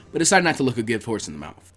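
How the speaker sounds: ordinary delivery, nothing marked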